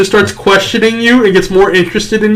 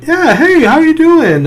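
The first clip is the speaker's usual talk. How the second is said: high-pitched mimicked hello; acted greeting; 12 semitones higher, slower than usual. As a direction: greet in a much higher imitation voice. Comedian's greeting acted in retold story.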